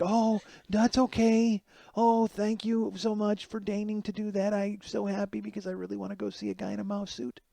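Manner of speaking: drip fake gratitude; sarcastic mock sweetness.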